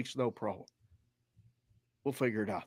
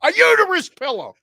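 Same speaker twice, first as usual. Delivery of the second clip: high, loud delighted gushing; overjoyed about the pillow; gleeful; 12 semitones higher, 6 dB louder than usual. Retold or imitated. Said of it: "And I said".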